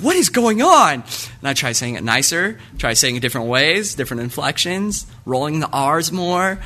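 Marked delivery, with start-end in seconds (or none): 0.0-0.9 high, loud confused outburst; mock bewildered cry; baffled. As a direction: throw up your hands in bewilderment. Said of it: "I was like".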